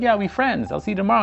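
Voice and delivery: upbeat friendly reassurance. Retold or imitated. Narrator reassuring Jason, retold.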